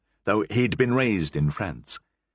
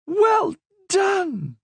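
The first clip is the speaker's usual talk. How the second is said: much higher, louder cheer; delighted praise; 4 dB louder than usual. Acted. Queen praising Berengon's translation, high delighted. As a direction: praise it with glee.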